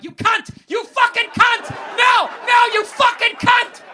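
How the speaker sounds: high shrieking yell of fury; furious screaming. Retold or imitated. Fan screaming at the driver on TV, furious shriek. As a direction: scream at the TV like a madman. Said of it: "I'm like".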